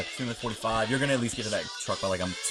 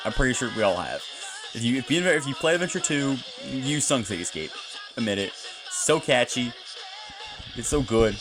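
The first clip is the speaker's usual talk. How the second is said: ordinary talk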